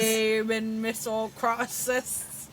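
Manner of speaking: slow, stretched-out Australian attempt; Australian accent. Shown as a demonstration. Co-host copying guest's phrase, slow Australian accent. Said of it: "in an Australian accent".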